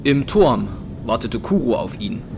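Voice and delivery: ordinary delivery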